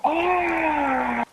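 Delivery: high, sudden pained cry; mock yell of pain. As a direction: yelp as the wrist snaps. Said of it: "And I go".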